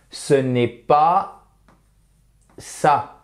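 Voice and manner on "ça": ordinary talk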